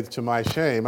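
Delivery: ordinary talk, nothing marked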